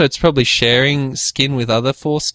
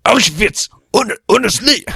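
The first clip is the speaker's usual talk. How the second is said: mock Hitler rant; 9 semitones higher than usual. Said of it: "go for a good old Adolf Hitler rant".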